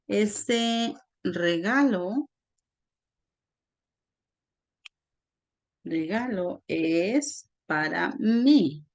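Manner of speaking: very slow, with long gaps between phrases; slow dictation. Shown as a demonstration. Teacher writing out an example for students, slow dictation. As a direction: dictate it piece by piece.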